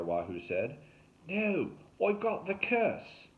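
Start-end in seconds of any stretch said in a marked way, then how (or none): 1.3-3.3 high, very slow, hammed-up Australian wail; hoarse bogus Aussie. A narrator's reading. Derek refuses to climb down, hammy Australian voice. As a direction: play a melodramatic fake-Aussie survivalist. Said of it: "toward the hoarse voice in the woods".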